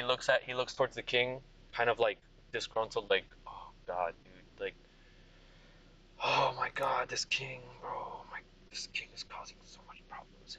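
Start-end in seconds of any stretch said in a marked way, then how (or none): 3.5-4.7 disgruntled mutter. Acted. Vega disgruntled aside, low quiet. 6.2-10.6 annoyed whisper about the king. Acted. Vega whispers complaint.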